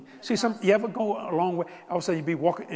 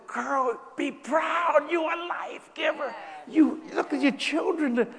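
high, ringing, slow encouragement; rousing exhortation; 11 semitones higher, slower than usual. Retold or imitated. Preacher urging the mother on the beach to be proud, high and fervent. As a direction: lift her up with fervour.

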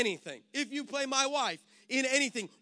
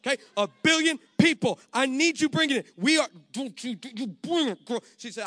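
ordinary talk

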